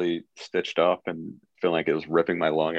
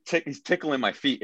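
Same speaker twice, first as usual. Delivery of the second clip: normal talking voice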